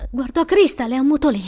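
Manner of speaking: ordinary delivery, nothing marked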